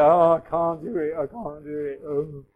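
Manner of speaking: mock moaning self-pity; hammed-up helpless moan; helpless whine. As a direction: whinge that you can't do it. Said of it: "like a helpless person".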